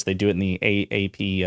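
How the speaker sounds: ordinary delivery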